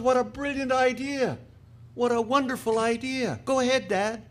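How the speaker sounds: high-pitched, delighted son; eager son. Acted. Son urging his dad to ask the computer, eagerly.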